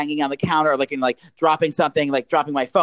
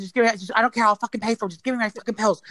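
higher, rushed-out exasperation; retold snapping; 6 semitones higher than usual. Fed-up customer demanding pills, retold snapping. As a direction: retell an angry demand without real volume. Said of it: "I finally just snapped".

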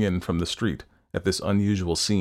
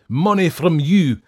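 loud, raised scornful refusal; indignant cry; 5 semitones higher, 7 dB louder than usual. A narrator's reading. Fettes refusing the doctor's money, indignant cry. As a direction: draw out the first words in outrage. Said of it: "cried Fettes".

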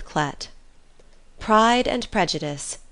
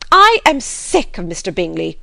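loud, fed-up exclamation; wife crying out that she has had enough; exasperated cry; 8 dB louder than usual. A narrator's reading. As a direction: burst out loudly in exasperation. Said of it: "cried his wife".

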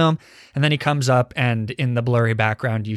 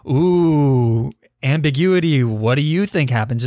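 mock intrigue; 3 semitones higher than usual. Podcaster mimics a film flaunting its ambiguous ending, mock intrigue. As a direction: play up the coy mystery, mockingly. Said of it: "the film is like".

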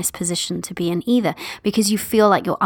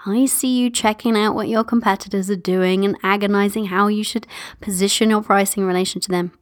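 ordinary talk

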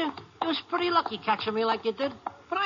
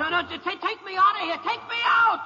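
higher and higher, yelling in terror; panicked shout; 9 semitones higher, 3 dB louder than usual. Acted. Prisoner shouts at the ranger to take him away, terrified. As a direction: scream to be taken away. Prisoner dragged past his enemy.

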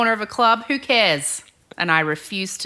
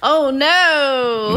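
higher, drawn-out "oh no"; dismayed reaction; 6 semitones higher, 4 dB louder than usual. Acted. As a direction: groan it out in storybook dismay. Reacting to the dramatic turn.

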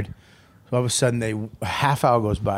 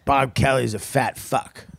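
speech therapy sentence; slower than usual. Retold or imitated. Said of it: "I'm at speech therapy".

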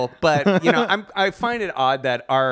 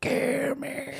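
tiny high, soft pleading voice; half-alive creature; 11 semitones higher, 8 dB quieter than usual. Pretend. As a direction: beg faintly, barely alive, as a joke. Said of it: "like, half alive, and it's like".

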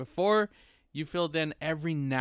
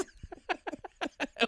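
high laughter; 14 semitones higher, 7 dB quieter than usual. Real, not acted. Laughs at the joke.

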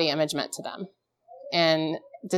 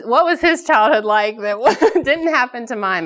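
higher and louder, laughing through the question; 6 semitones higher, 5 dB louder than usual. Real, not acted. To the audience about her husband, laughing disbelief.

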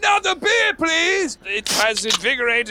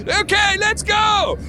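excited; 8 semitones higher, slower than usual. Acted. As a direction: fire up for the stunt. Launching the stunt.

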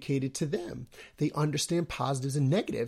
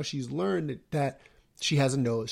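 ordinary talk

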